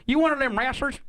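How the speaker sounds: high Southern yokel voice, drawling; southern twang. Shown as a demonstration. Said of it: "in a southern twang".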